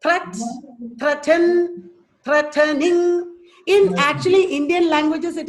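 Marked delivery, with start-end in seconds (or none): 0.0-3.3 THREAT, THREATEN, THREATENING, one beat added each time; THREAT, THREATEN, THREATENING as measured separate examples; syllable build-up demo. Shown as a demonstration. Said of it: "is three syllables".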